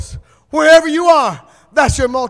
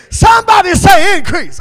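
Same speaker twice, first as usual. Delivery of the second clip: higher, louder call to the crowd; shouted call; 5 semitones higher, 6 dB louder than usual. Shown as a demonstration. Preacher calling the crowd to shout back. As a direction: demand the response.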